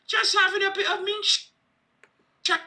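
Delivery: a cartoon British bobby brushing it off; mock British accent. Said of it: "the British authorities be like".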